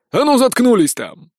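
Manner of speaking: loud, high bark from the cab; barked order. A narrator's reading. Sheriff yelling at the prisoners from the cab. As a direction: shut them up with a bark. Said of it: "гаркнул шериф".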